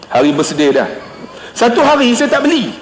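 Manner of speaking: ordinary talk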